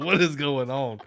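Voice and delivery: baffled and rattled; mock bewilderment; bewildered. Percy confused in dream.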